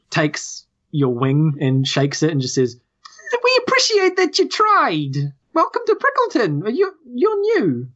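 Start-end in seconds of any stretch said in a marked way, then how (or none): 3.3-7.9 very high-pitched little squirrel. Acted. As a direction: squeak it out like a welcoming squirrel. Game master voices the squirrel.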